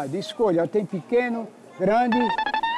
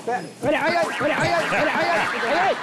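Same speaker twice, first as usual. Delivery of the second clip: gritos aflitos; 6 semitones higher than usual. Real, not acted. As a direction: yelp and call for the crew. Sendo atacado, pede socorro. (gritos de socorro aflitos)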